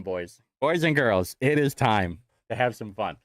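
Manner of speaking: usual speaking voice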